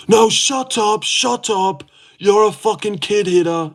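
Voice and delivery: high mock childish yell; whiny high put-on tantrum; hammed-up bratty shout; mocking a kid yelling. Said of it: "yelling like a kid".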